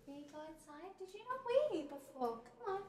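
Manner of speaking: hushed pet talk. Real, not acted. Owner quietly asking her poodle if it needs a wee. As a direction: murmur to the puppy close up. Talking quietly to dog off mic.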